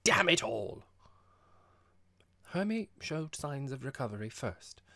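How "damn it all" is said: sudden loud, higher outburst; ungovernable irritation. A narrator's reading. Vexed host curses his laughing visitors, losing his temper. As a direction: burst out in exasperation. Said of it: "in a moment of ungovernable irritation".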